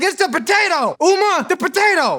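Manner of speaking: very high, loud comic outburst; exasperated yell. Retold or imitated. Viewer yelling at Uma over the potato, exasperated. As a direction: cry out in high-pitched disbelief.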